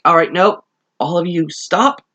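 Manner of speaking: mock commanding. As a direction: call a halt, lightly acted. Acted order to attacking ghosts.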